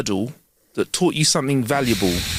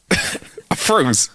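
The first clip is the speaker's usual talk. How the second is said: usual speaking voice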